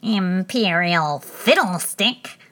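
slow, grumbling king; a sore, annoyed old king; grumpy king. Acted. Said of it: "said the king, rubbing his nose".

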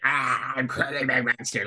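silly put-on creature voice, soft; Cronenberg monster. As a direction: groan it out like a mock monster. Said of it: "like a Lovecraftian monster and a Cronenberg monster".

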